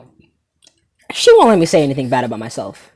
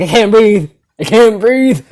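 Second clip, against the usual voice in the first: mock choking; 6 semitones higher, 6 dB louder, slower than usual. Imagined suffocating.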